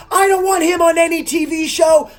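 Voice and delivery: high loud angry voice; fuming mom. Retold or imitated. Angry mom refusing the TV show, high loud. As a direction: snap back, outraged.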